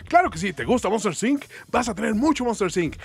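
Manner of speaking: pitchy, eager sales voice; over eager infomercial. Pretend. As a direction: hype it like a TV ad. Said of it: "Que era como un infomercial".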